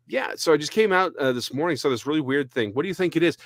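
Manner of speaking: friend's excited question. Retold or imitated. Friend voiced showing spider photo.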